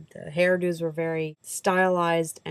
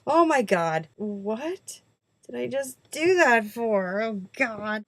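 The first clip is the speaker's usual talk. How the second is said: embarrassed squeal; 6 semitones higher, much slower than usual. Retold or imitated. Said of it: "I was just like".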